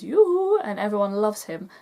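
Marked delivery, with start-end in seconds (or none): 0.0-0.6 much higher, much louder sing-song call; Oaken's yoo-hoo. Retold or imitated. Oaken greeting customers in the shop, loud high sing-song call.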